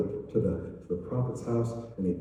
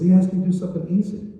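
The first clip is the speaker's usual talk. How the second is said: urgent servant; 9 semitones higher, 5 dB louder, faster than usual. Acted. Servant rebukes Naaman.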